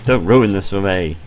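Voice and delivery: ordinary talk, nothing marked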